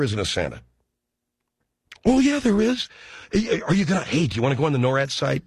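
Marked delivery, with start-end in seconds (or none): 2.0-5.4 much higher, strained pleading parent; cling to the magic, voice jumping up; desperate parent. Retold or imitated. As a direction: parent begging the kids to still believe, high and strained. Parent pleading Santa is real.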